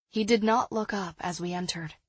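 usual speaking voice

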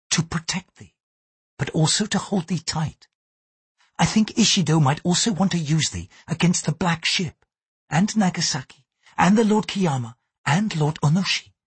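Mariko, gentle Japanese lady. A narrator's reading. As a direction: speak up in a high, earnest woman's register.

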